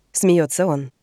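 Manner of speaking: plain reading voice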